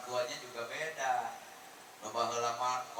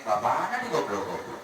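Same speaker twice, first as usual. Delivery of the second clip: shocked father; 8 dB louder than usual. Acted. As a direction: shout in disbelief at the insult. (bapa nu kaget ngagorowok)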